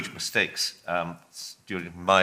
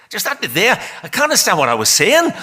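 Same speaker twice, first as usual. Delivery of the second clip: attempted Northern Irish accent, much higher and louder; a rough Northern Irish voice, raised and loud; 12 semitones higher, 8 dB louder than usual. [name] admits he can't follow his own words, in a rough Northern Irish accent. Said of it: "I can't do the accent, but I'll try".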